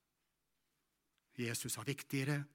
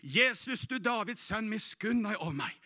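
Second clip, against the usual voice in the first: shouting louder; 8 semitones higher, 6 dB louder than usual. Acted. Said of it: "roper han enda høyere".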